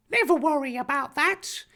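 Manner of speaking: high-pitched bold character voice; bold, brave declaration. A narrator's reading. Harry reassures his friend, high bold declaration. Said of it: "Harry declared boldly and bravely".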